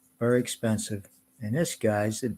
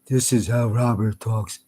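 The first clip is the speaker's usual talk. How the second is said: normal talking voice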